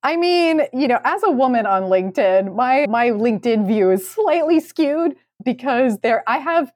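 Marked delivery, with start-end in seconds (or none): none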